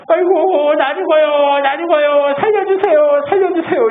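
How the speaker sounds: high, wailing mock old man begging for help. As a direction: imitate a frail old man crying out at night. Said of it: "이러고 있어요". (밤에 살려달라고 높게 우는 할아버지 흉내)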